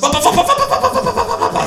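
sehr hoch und laut, rhythmisch wiederholt; trotziges Skandieren. Acted. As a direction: wie ein trotziges Kind skandieren. (hohes, lautes Trotz-Skandieren)

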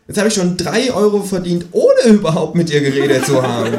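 high, bouncy know-it-all kangaroo; cheeky kangaroo voice. Acted. Kangaroo lecturing the narrator about precious time, high and cheeky. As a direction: play the smug kangaroo, pitched well up.

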